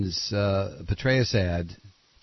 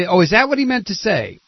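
high, loud mocking question; scornful sarcasm at the retraction; sarcastic; 9 semitones higher, 6 dB louder than usual. Real, not acted. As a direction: needle him with fake surprise. Mocking the clarification.

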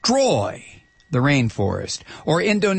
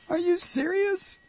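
much higher, softer incredulous squeak; 7 dB quieter than usual. Real, not acted. Host repeats his disbelief at a caller, squeaking higher.

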